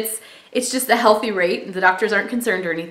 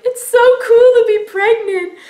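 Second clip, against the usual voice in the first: hammed-up high, loud tearful wail; mock happy crying; 6 dB louder than usual. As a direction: wail it like an overjoyed, sobbing mom-to-be. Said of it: "I just started crying".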